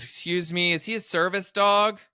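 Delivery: high, prissy busybody voice; prim market woman. Retold or imitated. Teller mimics the busybody vendor asking about his dog, high and prim.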